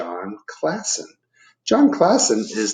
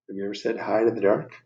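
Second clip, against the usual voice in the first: plain reading voice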